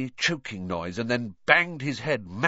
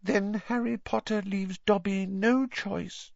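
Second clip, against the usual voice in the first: high, small elf voice, sorrowful; Dobby, sad; 10 semitones higher than usual. A narrator's reading. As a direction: resign yourself sadly, as a little elf. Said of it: "said the elf sadly".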